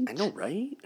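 "I know, right?" said high and sing-song, rising on "right"; squeaky copycat "I know, right?"; high mock echo. Real, not acted. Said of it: "echo of same words".

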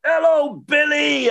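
put-on high, slow sing-song children's chant; slow high chorus of kids; kids' chorus reply. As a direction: chant it back like a crowd of kids.

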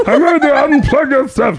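high sing-song chef gibberish; Swedish chef voice. Retold or imitated. Swedish chef impression continues.

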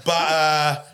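lightly faked Australian accent; mock Australian accent. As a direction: play a laid-back Australian shrugging off a bite. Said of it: "What kind of Australian accent was that?".

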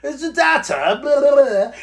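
high mock English accent; imitated English accent. Tempted to copy English colleagues, mock English accent. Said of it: "You almost wanna say it".

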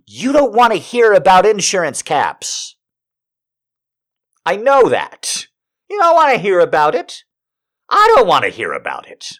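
high, loud exasperated rant; worked-up shouting, voice climbing; little rant. Acted. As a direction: rail at the audience in comic fury. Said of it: "have to do a little rant".

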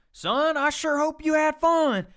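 slow, high hammed-up grandfather; grandfather impression. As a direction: ham up the sly grandpa. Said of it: "he goes".

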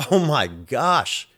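slow, drawn-out exasperated sigh of words; fuming. Retold or imitated. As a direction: let the frustration stretch out slowly. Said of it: "just, like, fuming".

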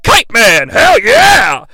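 high, loud mock-heroic yell; triumphant shout. Aang jumps off with the kite, triumphant mock yell. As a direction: holler it like a jubilant superhero cry.

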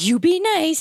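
very high, sing-song warning; high-pitched owner. Retold or imitated. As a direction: imitate an anxious owner squeaking at the dog. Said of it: "as soon as they take that higher tone".

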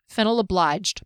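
usual speaking voice

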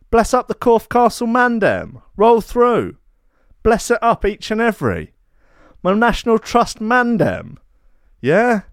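big up the castle crew, London street style; roadman slang voice. Pretend. Shouting out castle fans as a London roadman. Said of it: "The Natty T Roadman".

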